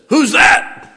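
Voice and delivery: high, loud sudden call from the dark; a woman's voice calls sharply from the dark basement, high and loud; startled woman calling out. Retold or imitated. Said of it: "and a woman says".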